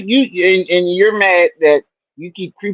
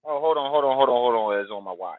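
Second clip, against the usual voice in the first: muffled; 6 semitones lower, 10 dB quieter than usual. Real, not acted. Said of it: "I think you're still on your watch".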